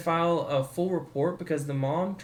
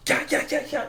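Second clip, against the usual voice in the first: mock battle cry; 11 semitones higher than usual. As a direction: hype yourself up mid-fight. Acting out the fight cry.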